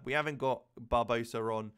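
ordinary talk, nothing marked

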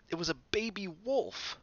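ordinary delivery

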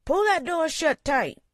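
high, loud gruff barkeep calling out; raspy male voice. A narrator's reading. Bartender calling to a newcomer, gruff and loud. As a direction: bark it across the bar like a gruff barman. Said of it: "called a raspy male voice".

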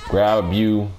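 normal talking voice

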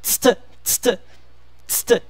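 tst as bare consonants, almost voiceless; isolated consonant cluster. Shown as a demonstration. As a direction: repeat tst crisply with gaps between. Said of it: "Vamos falar ar e depois tst".

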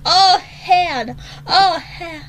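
high, loud frightened groan of oh hell; scared oh hell. Real, not acted. Girl spooked by the app's question, frightened. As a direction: let the fear jump out of you.

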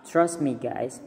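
ordinary talk

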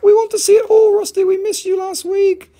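very high, loud mock clamouring; eager fans impression. Seller imitates viewers' demands, high squealed mock pleading. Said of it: "You're like".

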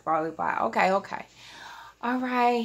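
ordinary talk

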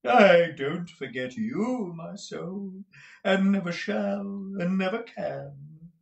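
high, slow, syrupy drawl; affected, adoring husband. Acted. Husband placating his jealous wife, high gushing drawl. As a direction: croon it in drawn-out high devotion.

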